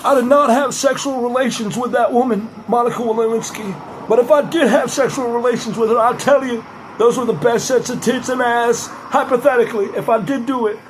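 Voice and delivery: sleazy Clinton denying it; mock Bill Clinton confession; Bill Clinton impression. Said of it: "I can do Bill Clinton".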